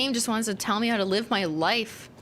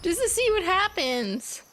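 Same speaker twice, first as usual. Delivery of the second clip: silly high voice. Pretend. Taunting the game about the train, silly high squeal. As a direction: squeal it like a gleeful troublemaker.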